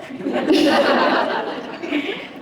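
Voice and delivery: a bright burst of laughter at herself; sudden self-mocking laugh; real laugh. Real, not acted. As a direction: laugh it off, amused.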